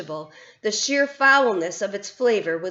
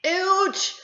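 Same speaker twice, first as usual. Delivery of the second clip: roar of pain; 10 semitones higher than usual. A narrator's reading. Bloodbottler biting into Sophie, roar. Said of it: "roared the blood bottler".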